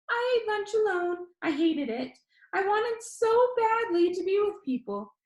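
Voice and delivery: high, bright-voiced video host; upbeat YouTuber. A narrator's reading. YouTuber Danny asks the viewer, upbeat and high. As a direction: pitch it up like an eager vlogger.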